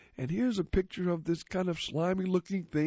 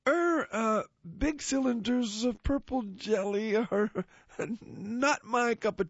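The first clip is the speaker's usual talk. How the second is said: hesitant donkey voice; 7 semitones higher than usual. Acted. Pedro politely declines jelly.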